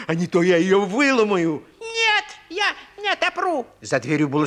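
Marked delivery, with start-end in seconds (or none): 0.1-1.6 loud, low wolf threat; growling wolf. A narrator's reading. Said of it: "прорычал волк". 1.8-3.7 high, frightened piglet. A narrator's reading. Piglet answers the wolf.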